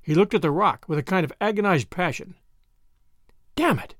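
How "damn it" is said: high-pitched burst of exasperation; agitated cry. A narrator's reading. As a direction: cry out, pitched up but not shouted. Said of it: "he cried".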